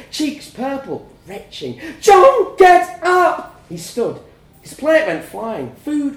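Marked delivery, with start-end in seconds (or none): 2.0-3.6 very loud, high panicked cry; panicked scream. A narrator's reading. Wife to choking husband, screaming in panic. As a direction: scream his name in terror.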